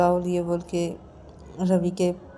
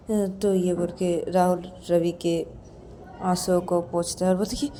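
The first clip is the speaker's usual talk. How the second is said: ordinary delivery, nothing marked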